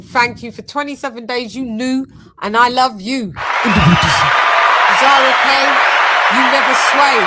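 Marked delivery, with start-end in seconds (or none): 3.3-7.3 loud, drawn-out hype call of the name. Real, not acted. Streamer hyping up a member, loud and slow. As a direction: belt out the name like a ring announcer.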